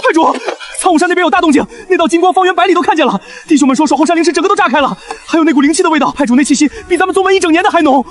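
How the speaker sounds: fast, high-pitched breathless-with-news delivery; excited underling reporting; urgent report. Acted. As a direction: rush the news to the boss.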